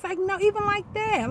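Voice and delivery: ordinary talk, nothing marked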